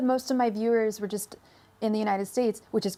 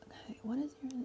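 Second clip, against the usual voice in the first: whisper; 4 semitones higher, 13 dB quieter, faster than usual. Real, not acted. Whispered aside.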